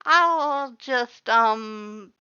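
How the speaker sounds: high, loud hesitant voice; nervous Papyrus. A narrator's reading. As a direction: trail off nervously. Papyrus backs away worried.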